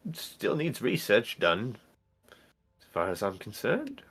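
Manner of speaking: hesitant professor with big pitch swoops; halting, singsong academic character voice; dithering professor. Acted. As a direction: swoop up high and loud on key words, then drop.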